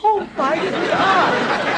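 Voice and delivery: high, drawn-out gasp of horror; stunned, stretched-out alarm; shocked. Acted. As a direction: react to seeing something impossible. Grandma first sees the alien.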